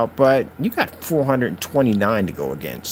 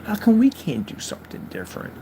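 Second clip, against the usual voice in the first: much higher, whiny mock of the critics; mocking naysayer; 8 semitones higher, 5 dB quieter than usual. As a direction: whine it like a jealous critic. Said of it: "they go".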